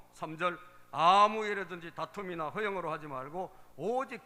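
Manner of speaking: loud, emphatic verse reading; scripture reading. Acted. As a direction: proclaim the verses to the congregation. Reads verses aloud to congregation. (큰 소리로 또박또박 성경 봉독)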